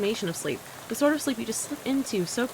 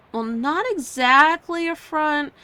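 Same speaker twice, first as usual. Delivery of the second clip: slow hesitant hedge; 7 semitones higher, 6 dB louder, slower than usual. Acted. Hesitant admission, pitch jumps.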